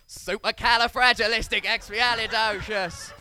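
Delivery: slow, drawn-out bad Cockney; hammed-up Cockney, stretching every syllable; panicked mock Cockney. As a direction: blurt it loud in a desperate fake Cockney. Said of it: "he visibly panicked".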